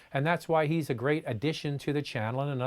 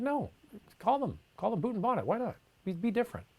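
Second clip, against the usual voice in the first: much higher, light quoted reply; offhand reassurance; 8 semitones higher, 4 dB quieter than usual. Retold or imitated. Host recalls encouraging colleague to keep British terms, high-pitched reassurance.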